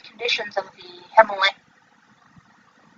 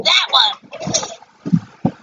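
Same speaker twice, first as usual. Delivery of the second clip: high, eager confirmation; pitched-up agreement; excited recognition; 5 semitones higher, 3 dB quieter than usual. Real, not acted. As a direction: exclaim it as the name clicks. Remembers the show's name.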